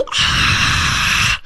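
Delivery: breathy horror-movie scream; long airy scream, imitated. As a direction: mimic a ghost's sudden scream. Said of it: "comes from the backseat and screams".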